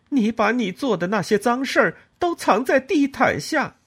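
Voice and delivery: 高声尖锐、越说越狠的斥责; 把所有怨气都砸向婆婆; 愤怒怒斥. A narrator's reading. 和婆婆争吵怒斥. (高声愤怒地斥责婆婆)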